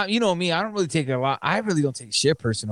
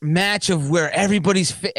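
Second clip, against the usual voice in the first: ordinary delivery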